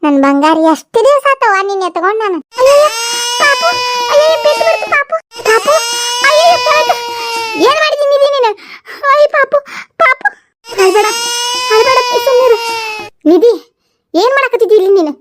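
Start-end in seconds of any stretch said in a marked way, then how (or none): 2.6-12.6 put-on high, alarmed cries over the fallen baby; acted panic as the baby falls; panicked alarm. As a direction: gasp it out in sudden panic.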